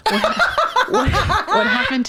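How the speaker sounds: laughing through the question; words broken by real laughter. Real, not acted. Nurse retelling the toe shock to coworker, laughing.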